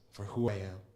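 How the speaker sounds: normal talking voice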